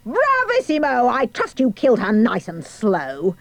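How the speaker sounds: high queenly cry, settling into relish; exultant cry. A narrator's reading. Queen delighted by the killing, high gloating cry. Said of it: "The Queen cried out".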